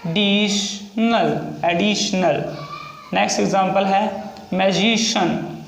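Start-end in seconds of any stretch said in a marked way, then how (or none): 0.1-2.6 extremely slowed dictation; very slow stretched word. Shown as a demonstration. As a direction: pull the word out syllable by syllable. Repeats word while writing.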